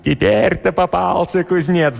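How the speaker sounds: high, drawn-out gloating; gloating devil. A narrator's reading. Devil on the blacksmith's neck thinking he has him, gloating. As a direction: crow slowly over a caught victim. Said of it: "начал от радости галопировать".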